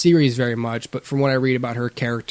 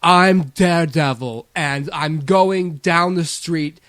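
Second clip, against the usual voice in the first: higher, slow mock American hero with British accent leaking in; British accent pushing through; 5 semitones higher, slower than usual. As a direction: play a Brit straining to keep an American accent. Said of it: "Ignore my accent that's trying to push through".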